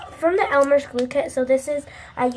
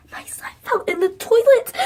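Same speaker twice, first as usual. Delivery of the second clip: breathless whisper with a laugh running through it; half laughing, half horrified, under your breath; 9 semitones higher, 6 dB louder than usual. Real, not acted. Girl reporting the slime fell in the toilet, laughing whisper.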